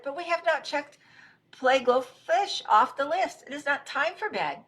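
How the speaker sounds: high, insistent objection; indignant protest. Acted. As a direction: object as if rules were broken. Rabbit protests robot's nap.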